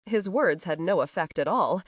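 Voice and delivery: plain reading voice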